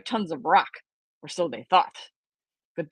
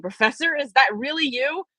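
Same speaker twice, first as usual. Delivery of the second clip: excited; 6 semitones higher than usual. A narrator's reading. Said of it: "so excited and overjoyed".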